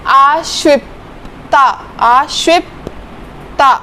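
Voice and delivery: आश्विप्ता slowly with a clear p; slow pronunciation demo. Shown as a demonstration. Teacher modelling the p sound, slow. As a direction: draw out आश्विप्ता, p before ta. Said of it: "तो प".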